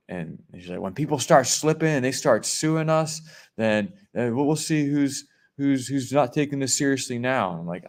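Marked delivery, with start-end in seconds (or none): 0.8-7.5 high-pitched mock rant; imitated angry scolding. Store lady berating a shopper about lawsuits, imitated scolding. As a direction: imitate an indignant lady's warning, mildly hammed-up. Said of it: "screamed at me".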